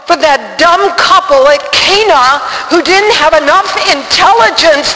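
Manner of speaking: high, loud, outraged rant at God; retold anger. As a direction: argue with God at full, indignant shout. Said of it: "I really got mad".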